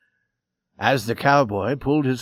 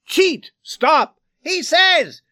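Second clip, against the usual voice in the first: high, ringing single cries; sharp screamed fragments; 5 dB louder, much slower than usual. A narrator's reading. Brawlers' words cutting through the uproar, sharp high cries. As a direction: fire off each scrap as a high shout. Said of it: "cut the screaming and rang out sharply".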